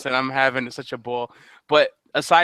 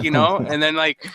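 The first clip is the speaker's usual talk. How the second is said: ordinary talk, nothing marked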